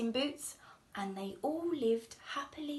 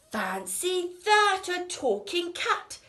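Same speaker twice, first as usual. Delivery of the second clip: amazed; 7 semitones higher, 7 dB louder than usual. Acted. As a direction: marvel at the cat. Woodcutters amazed.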